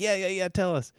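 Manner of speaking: high-pitched eager begging; eager kids. Retold or imitated. Boys urging their uncle to tell the story, eager.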